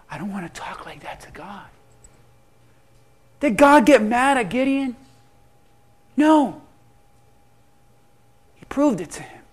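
usual speaking voice

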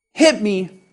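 normal talking voice

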